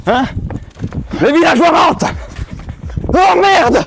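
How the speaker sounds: loud, high alarmed cry; panicked shout. Acted. Mage panics, villagers lied.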